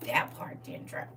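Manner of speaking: soft unvoiced whisper; hushed whisper; whispered aside. Real, not acted. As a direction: whisper it to yourself.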